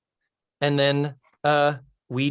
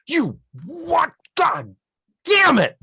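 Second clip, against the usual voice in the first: much higher, rising to a loud yell; Tootie's furious outburst; 5 dB louder, slower than usual. Retold or imitated. Tootie yelling at Natalie for repeating her words. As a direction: shriek of disbelief that boils into a curse.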